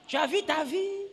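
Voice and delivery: normal talking voice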